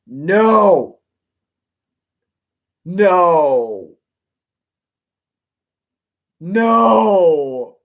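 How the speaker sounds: loud, pleading cries of no; horrified shout. Real, not acted. Reader protesting the plot twist, shouting in horror. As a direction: yell it out in real dismay.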